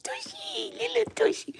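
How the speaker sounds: very high, sing-song cooing; squealed baby talk. Retold or imitated. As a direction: imitate yourself squealing at a cute toddler.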